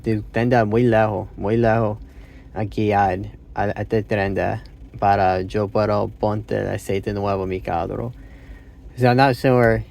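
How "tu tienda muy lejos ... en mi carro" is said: halting learner's Spanish; broken Spanish. Real, not acted. Learner explaining the store is far away, halting broken Spanish. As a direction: stumble through it in beginner Spanish. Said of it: "say it in my broken Spanish".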